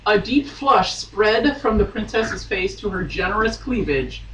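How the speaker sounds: ordinary talk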